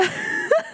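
high-pitched giggle; amused laugh. Real, not acted. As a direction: crack up at your own comeback. Laughs at her own comeback.

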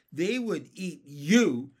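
slow, loud gleeful tease; grinning. A narrator's reading. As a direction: relish scaring the worm.

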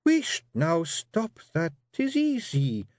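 high, slow sharp Scots scolding; snapping Jeanie. Acted. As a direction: cut him off short. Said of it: "snapped Jeanie".

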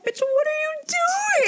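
shocked. Retold or imitated. Shocked exclamation at friend.

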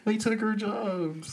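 South Park townsfolk. Acted. Co-host quoting the cartoon, high drawn-out mock complaint. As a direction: drawl it in a high put-on cartoon voice. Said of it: "Is that a South Park reference".

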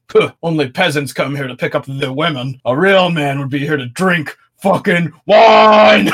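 mock-pompous snob building to a loud finish; haughty wine snob. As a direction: lecture the peasants, then bellow it. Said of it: "there's one guy who's like".

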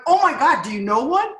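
loud, high burst of urgent hope; re-enacted eager desperation. Asking friend for a sponsor, eagerly desperate. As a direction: pounce on the offer, acted out.

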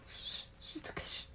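whispered shushing, soothing; rhythmic shushing. Retold or imitated. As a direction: hush a scared baby softly. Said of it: "He's like".

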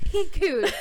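He cooed said high and loud in mockery; mocking he cooed. Shown as a demonstration. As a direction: make fun of the cheesy he cooed. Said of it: "he cooed is straight out of Wattpad".

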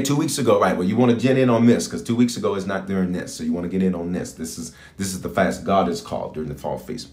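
normal talking voice

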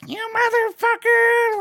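high, loud, put-on old-lady screech; imitated old lady cursing. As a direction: screech it like an old woman flipping out. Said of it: "I can hear her like".

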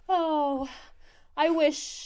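high, drawn-out melodramatic sigh of longing; hammed-up dying wish; fake dramatic. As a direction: play it as a big fake tragedy. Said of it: "him being so, like, fake dramatic".

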